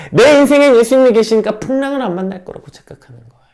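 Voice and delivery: loud, high, impassioned sermon voice; preaching at full force. Acted. Pastor to congregation, loud impassioned preaching. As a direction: preach it hard, raising the voice to the rafters. Preacher rebukes distorted faith.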